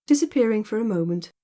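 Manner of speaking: usual speaking voice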